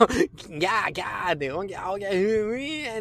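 baby crying imitation. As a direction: imitate a baby's night crying. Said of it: "赤ちゃんの頃は夜泣きってさ". (赤ちゃんの夜泣きの真似)

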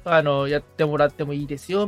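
ordinary talk, nothing marked

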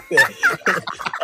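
sharp squeal of a laugh; high burst of laughter. Real, not acted. Laughing at own story. (甲高い笑い声)

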